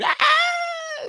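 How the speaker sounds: high-pitched excited shriek; cheerleader scream. Pretend. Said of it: "screaming like a cheerleader".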